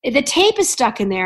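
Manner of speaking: normal talking voice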